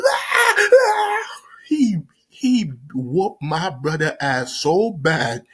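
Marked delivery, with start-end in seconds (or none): 0.1-1.2 very high, wailing mock scream; imitated scream. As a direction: mimic screams of someone being whooped. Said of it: "you heard".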